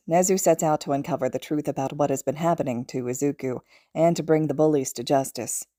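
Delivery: usual speaking voice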